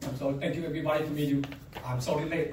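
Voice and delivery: ordinary talk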